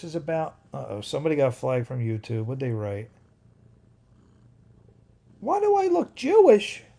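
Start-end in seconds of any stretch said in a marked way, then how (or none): 5.4-6.8 much higher and louder put-on voice; hammed-up read of a viewer's question; mock voice for a commenter. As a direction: read the comment in a silly squeaky voice.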